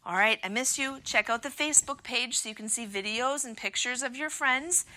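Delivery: ordinary talk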